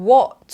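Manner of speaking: WHAT with a short rounded o, crisp T; British short o. Shown as a demonstration. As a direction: say WHAT with small rounded lips, British style. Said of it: "British ก็จะปากกลมเล็กนะ". (พูด what ปากกลมเล็กแบบอังกฤษ)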